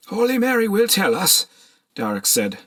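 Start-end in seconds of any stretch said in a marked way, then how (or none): 0.1-1.5 much higher, strained plea; cling to hope through grief; trembling old monk. A narrator's reading.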